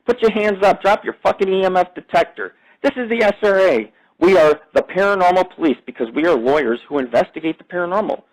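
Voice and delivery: high, barking mock police voice; mock cop order. Man imitating paranormal police yelling at him. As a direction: bark it like a cop making an arrest. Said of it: "But they go".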